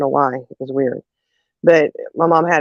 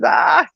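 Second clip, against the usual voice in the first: mother's alarmed shout; 11 semitones higher than usual. Retold or imitated. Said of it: "and she's".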